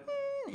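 high little dog whine; imitating the dog still whining; dog whimper. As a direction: whine like a hurt puppy. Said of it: "she's whimpering".

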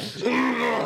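a squeaky fake cough to cut in; high pointed throat clear. Teasing partner who cut in, a squeaky throat clear.

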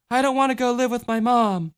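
put-on high childlike voice; little girl voice. A narrator's reading.

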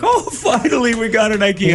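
very high-pitched cheering shopper; mock cheering. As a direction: imitate an overjoyed crowd in a high squeal. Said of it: "they're, they're cheering".

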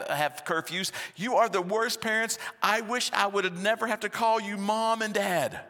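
put-on high, loud screaming teenage daughter. Daughter screaming at her parents, high and loud. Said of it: "and she screams".

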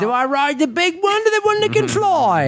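high, silly put-on foreign accent, a dumb Sophie voice; host mocking Sophie choosing a dinosaur, silly high accent; stupid Sophie's Choice accent. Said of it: "I was just looking to do a stupid accent".

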